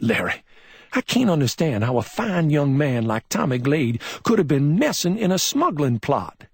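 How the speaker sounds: a mild hint of Chuck's disbelief; lightly voiced Southern deputy. A narrator's reading. Chuck cannot believe his friend smuggled, mildly troubled.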